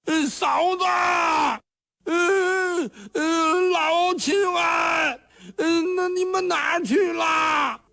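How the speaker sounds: high, wailing calls with sobs, the last name stretched slowly; a lost simpleton calling out for his family, close to tears; desperate crying shout. A narrator's reading. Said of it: "喊了老半天".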